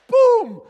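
very loud, high vocal blast; explosive boom. Acted. Preacher showing the Spirit striking the Word, explosive boom. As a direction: burst it out like an explosion. Imitated burst as Spirit acts.

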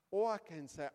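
normal talking voice

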